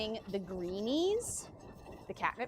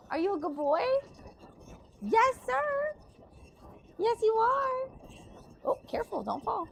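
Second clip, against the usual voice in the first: talking to a dog; 13 semitones higher than usual. Real, not acted. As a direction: squeal praise at the puppy. Praising and warning dog.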